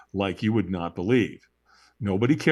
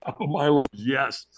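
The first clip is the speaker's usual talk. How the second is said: normal talking voice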